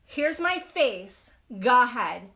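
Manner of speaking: put-on calm dare to the girl who wants to fight; defiant dare. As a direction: offer your face slowly, daring her. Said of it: "So I said".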